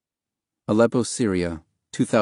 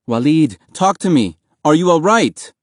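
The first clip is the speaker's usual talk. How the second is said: loud, high, frantic plea; desperate shouting; 6 semitones higher, 6 dB louder than usual. A narrator's reading. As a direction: shout at him to answer. Boy begs brother answer.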